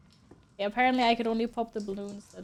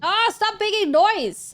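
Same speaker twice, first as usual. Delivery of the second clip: annoyed shout; 9 semitones higher, 6 dB louder, slower than usual. Real, not acted. Yelling at the noise.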